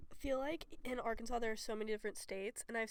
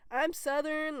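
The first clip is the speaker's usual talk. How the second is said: a put-on Southern drawl, higher and louder; 6 semitones higher, 9 dB louder, much slower than usual. Nervous freshman at rush week faking a Southern drawl. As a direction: ham up a fake Southern accent to fit in.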